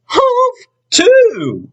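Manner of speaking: tiny squeaky copycat voice, very high; baby kangaroo. Acted. Young kangaroo echoing its mother, very high squeak. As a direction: squeak it like a cheeky little joey. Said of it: "the young kangaroo in her pouch said".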